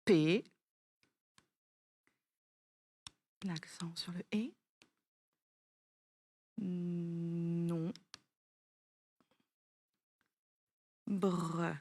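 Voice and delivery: P, non, bre low and slow, with a soft aside about the accent on the E; a slow, careful syllable-by-syllable correction of pénombre. Shown as a demonstration. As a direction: correct by spelling the word out syllable by syllable. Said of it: "On corrige ensemble".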